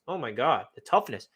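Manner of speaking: normal talking voice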